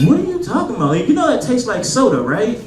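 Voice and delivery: high-pitched, incredulous challenge; exasperated. Retold or imitated. Comic correcting friends who call Moscato wine, exasperated. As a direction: challenge them, voice leaping up. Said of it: "it pisses me off when my friends are like".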